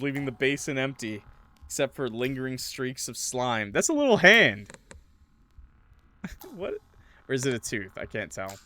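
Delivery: ordinary delivery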